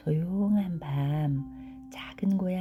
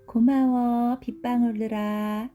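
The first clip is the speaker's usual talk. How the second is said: higher, louder thankful voice; grateful story character; 7 semitones higher, 6 dB louder than usual. Acted. Forest character thanking the raindrops, high and clearly projected. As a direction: call it up to the raindrops, pitched high.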